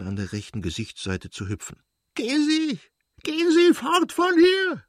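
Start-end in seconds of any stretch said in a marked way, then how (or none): 2.1-4.8 very high, loud shriek; piercing scream. A narrator's reading. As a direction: scream at him to get out. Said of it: "schrie sie noch durchdringender". (durchdringend schreien)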